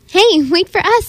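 high, playful calling-out without laughter; giggly call. A narrator's reading. Cassie calling after her speeding dog, high giggly call.

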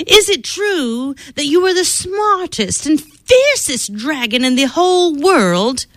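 high, sweet-talking princess voice; girl buttering up a vain dragon; flattering princess. Acted. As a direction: coax the dragon with wide-eyed praise.

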